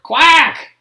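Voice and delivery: loud quacked reply; loud duck quack. A narrator's reading. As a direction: quack back loudly like a tired duck. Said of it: "The duck answered".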